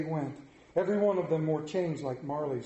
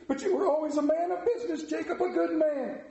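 faltering; 9 semitones higher than usual. A narrator's reading. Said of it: "faltered Scrooge".

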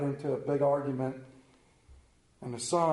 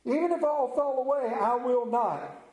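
emphatic declaration; 7 semitones higher than usual. Acted. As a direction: declare it loftily, landing hard on the refusal. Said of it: "Peter declared".

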